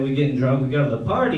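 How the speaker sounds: ordinary talk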